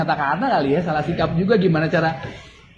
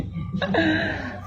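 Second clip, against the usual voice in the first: a quick high embarrassed laugh; soft embarrassed chuckle; short laugh; 12 semitones higher, 7 dB quieter than usual. Real, not acted. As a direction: laugh it off lightly. Laughs at own mistake.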